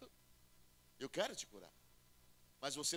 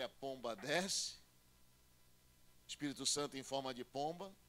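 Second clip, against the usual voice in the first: normal talking voice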